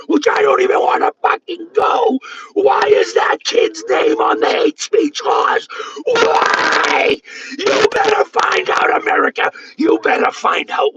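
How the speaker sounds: high, loud outraged yell; furious shouting. Real, not acted. Man ranting at America over hate laws, real furious shouting. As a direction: demand answers at a full high-pitched shout. Enraged rant demanding answers.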